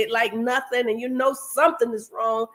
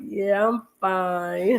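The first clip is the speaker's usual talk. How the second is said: sleepy sick reply; 4 semitones lower, much slower than usual. Retold or imitated. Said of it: "And I'm like".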